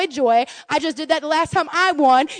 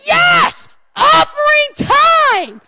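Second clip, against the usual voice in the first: much higher, louder, stretched-out cheer; cheerful giver; 11 semitones higher, 5 dB louder, much slower than usual. Pretend. Churchgoer eager to give, shouting cheerfully. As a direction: shout it like the best news of the day. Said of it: "we should be like".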